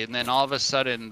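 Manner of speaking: ordinary delivery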